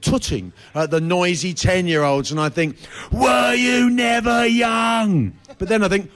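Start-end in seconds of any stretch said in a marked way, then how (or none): 3.1-5.3 sung-out outraged cry, slow and high; mock outraged cry. Comic voicing his own thought at grumpy couples, mock wail. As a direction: stretch it out like a wail of disbelief.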